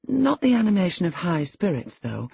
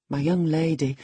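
usual speaking voice

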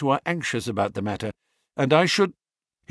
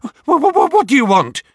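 frightened, stammering and high; startled man stuttering in fear; a scared man caught off guard, stammering; quavering voice; 9 semitones higher, 7 dB louder, faster than usual. A narrator's reading. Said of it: "he asked in a quavering voice".

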